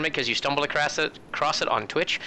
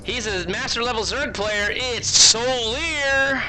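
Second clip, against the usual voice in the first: announcer; 4 semitones higher, slower than usual. Acted. Hypes the new player intro.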